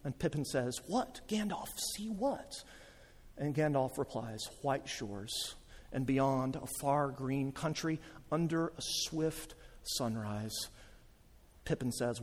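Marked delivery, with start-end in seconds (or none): 0.9-2.6 soft, raised questioning voice; small hushed voice of a puzzled hobbit; wondering hobbit. Acted. Pippin asks Gandalf in wonder. 4.6-10.7 soft, low, slow description; quiet, reverent storytelling; hushed wizard. Acted. Gandalf describes what lies beyond.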